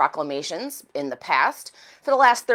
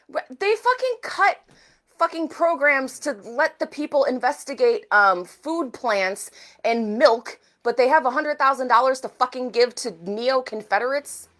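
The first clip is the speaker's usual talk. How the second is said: outraged; 5 semitones higher than usual. Real, not acted. Outraged rant about funding.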